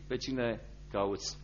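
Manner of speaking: soft, slow, tender asking; a softly slowed question; hushed and slow. Acted. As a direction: let the question fall quietly, drawn out. Gardener's question quoted twice.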